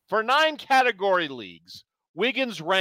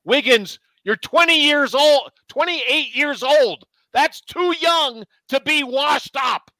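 put-on old man rant; 7 semitones higher than usual. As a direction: scold like a grumpy old man. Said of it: "your old man rant".

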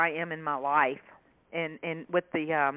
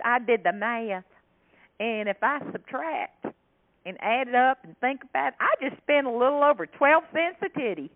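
higher Southern country reckoning; country accent; 6 semitones higher, 3 dB louder than usual. Retold or imitated. Shopper explaining her math, higher country voice. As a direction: count it up like a proud bargain hunter. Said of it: "She said".

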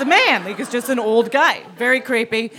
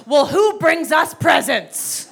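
slow, raised mock-kid wail; higher, drawn-out childish complaint; child's indignant protest; 5 semitones higher, slower than usual. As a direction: imitate an outraged kid, drawing out each word.

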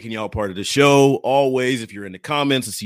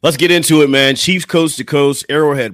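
hype intro; 4 semitones higher, 10 dB louder than usual. Real, not acted. Podcast show opener.

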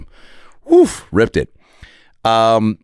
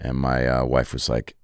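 ordinary delivery

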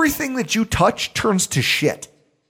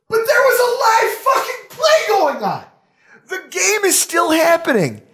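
shouting in disbelief at the refs; outraged shout; 12 semitones higher than usual. Real, not acted. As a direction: yell it, outraged. Ranting about the ref letting play go on.

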